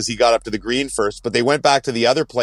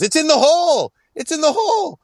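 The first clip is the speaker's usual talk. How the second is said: high-pitched imitation of a golfer calling out; mock distant yell. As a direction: mimic a far-away cry in a very high voice. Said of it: "all of a sudden he hears yells".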